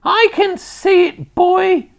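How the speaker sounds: very high, slow screech; loud, piercing Grandma voice; old woman shouting back. A narrator's reading. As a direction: holler back impatiently to the boy. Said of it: "the old girl shouted back".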